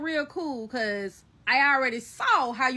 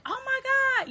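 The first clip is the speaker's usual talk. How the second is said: retold shock; 13 semitones higher, much faster than usual. Recounting the cat attack on a housemate, high-pitched shock. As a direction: exclaim it way up high, aghast.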